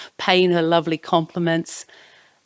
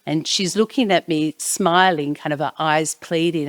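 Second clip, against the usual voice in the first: ordinary talk, nothing marked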